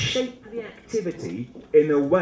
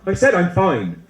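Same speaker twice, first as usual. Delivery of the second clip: put-on louder, higher teenage protest; huffy teenager; 4 semitones higher, 6 dB louder than usual. Acting the teenager everyone has met, huffy. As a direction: snap it out like a fed-up teen. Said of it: "You know that teenager".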